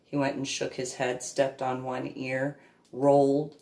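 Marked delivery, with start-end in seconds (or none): none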